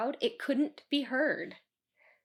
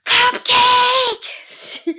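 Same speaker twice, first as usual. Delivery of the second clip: very high, loud shrieked cupcake; screamed demand; 13 semitones higher, 15 dB louder than usual. Retold or imitated. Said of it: "and she says".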